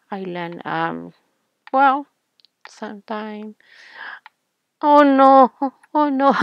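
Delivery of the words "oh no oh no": loud, high panicked oh no; scared oh no. Real, not acted. Seeing who the new villager is, loud scared cry. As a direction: yelp it out in real alarm. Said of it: "I'm scared".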